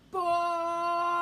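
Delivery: loud, long drawn-out boredom; bored groan. A narrator's reading. Storyteller voicing a shepherd boy stuck in the pasture, bored. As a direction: stretch it out in loud exaggerated boredom.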